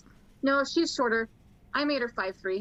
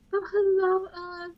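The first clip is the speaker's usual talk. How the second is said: high, sing-song greeting; cooing to an elephant; 8 semitones higher, 4 dB louder than usual. Acted. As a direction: coo it as to a big sweet animal. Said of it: "she's going to talk to the elephant".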